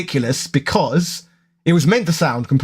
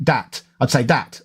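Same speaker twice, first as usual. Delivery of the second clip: Cockney "dat" with a d for th; 4 semitones lower than usual. Shown as a demonstration. Showing his old Cockney speech, Cockney "dat". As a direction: drop the th, say "dat" like a Londoner. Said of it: "if I talk more, more freely".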